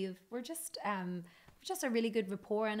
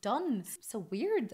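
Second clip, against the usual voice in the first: ordinary talk, nothing marked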